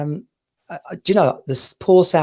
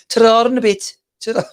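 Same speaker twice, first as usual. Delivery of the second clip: higher, louder Brummie accent, a bit hammed; playful stab at a Brummie accent; Brummie accent attempt; 9 semitones higher, 5 dB louder than usual. Shown as a demonstration. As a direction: have a go at a Birmingham accent. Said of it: "I can attempt a bit of a Brummie".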